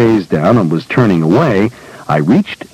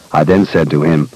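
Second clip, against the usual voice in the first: ordinary delivery, nothing marked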